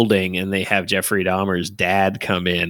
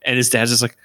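ordinary talk, nothing marked

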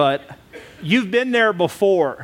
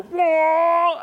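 startled whoa; 12 semitones higher than usual. Retold or imitated. Reaction during the chase.

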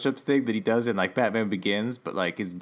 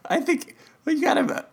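laughing. Real, not acted. Laughs at own claim.

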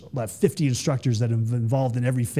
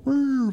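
high whirr noise; 13 semitones higher than usual. Retold or imitated. Imitating a hesitant jumper's noise.

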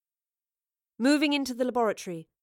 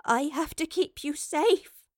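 slow, higher, trembling voice; emotional, wavering and slow; tremor in the voice; 6 semitones higher, slower than usual. A narrator's reading. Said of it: "a tremor in her voice".

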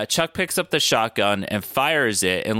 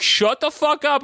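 exasperated dad; 13 semitones higher than usual. Retold or imitated. Imitating dad snapping at kid.

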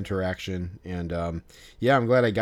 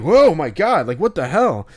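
mock shock; 6 semitones higher, 7 dB louder than usual. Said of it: "milking it".

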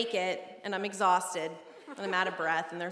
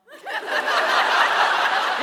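very high, loud laughter; shrieking laugh; 11 dB louder than usual. Real, not acted. Big laugh at dad story.

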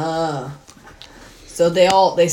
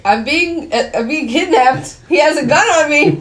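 higher-pitched cry for help, rising as it goes; mock alarmed plea; 7 semitones higher than usual. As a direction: imitate a hostage appealing to bystanders, hammed-up. Retold kidnap plea in police station.

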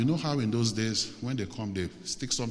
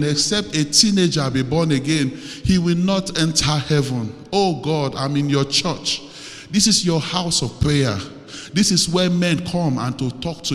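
raised, imploring prayer voice; urgent pleading, building in volume and insistence; fervent prayer; 3 semitones higher, 7 dB louder than usual. Real, not acted. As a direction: cry out to God on the congregation's behalf. Leads fervent congregational prayer.

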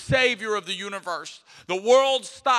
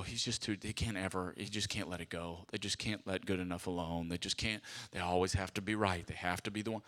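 low and much softer, griping; muttered complaint; 10 semitones lower, 10 dB quieter than usual. Retold or imitated. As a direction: grumble it under your breath. People complaining, muted.

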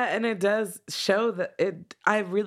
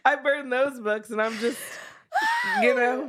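laughing; 7 semitones higher than usual. Real, not acted. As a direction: talk through real laughter. Laughing self-mockery.

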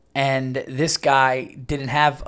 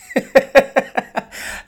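high, breaking laugh; real laughter; 12 semitones higher, 5 dB louder than usual. Real, not acted. Man amused recalling women screaming and dogs barking, real laughter. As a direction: burst out laughing at your own story. Laughs at his own story.

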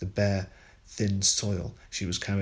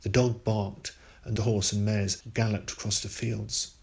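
usual speaking voice

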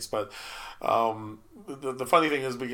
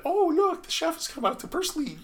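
high, gushing mock customer; put-on high-pitched customer excitement; gushing diner. Cook mimicking diners greeting the chef, gushing. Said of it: "people would get there".

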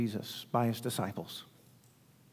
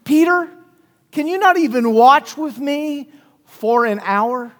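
much higher on Peter, then a pained plea; Jesus rebuking; 7 semitones higher, 4 dB louder than usual. Retold or imitated. Preacher voicing Jesus waking Peter, high and pained. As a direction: call out to him, hurt and disappointed. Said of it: "And then he says".

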